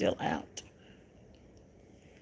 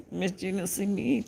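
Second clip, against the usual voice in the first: usual speaking voice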